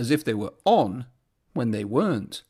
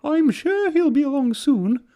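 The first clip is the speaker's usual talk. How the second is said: much higher, soothing coach voice; high coach voice, calming; soothing coaches; 12 semitones higher, 3 dB louder than usual. A narrator's reading. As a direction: reassure him gently. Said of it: "replied Annie and Clarabel".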